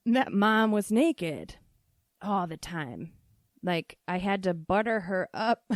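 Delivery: ordinary talk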